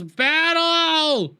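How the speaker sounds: Mama Zabrak yelling to her coven; battle cry. Retold or imitated. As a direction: call the charge. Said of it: "Mama Zabrak yells".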